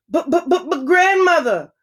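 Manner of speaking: put-on girl frightened by grandmother's teeth; frightened stammer. A narrator's reading. Girl sees sharp teeth.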